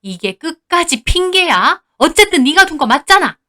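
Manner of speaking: angry scolding. Acted. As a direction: refuse the excuse. Mother-in-law scolds the narrator's character. (짜증스럽게 몰아붙이는 말투)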